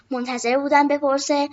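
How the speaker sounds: ordinary delivery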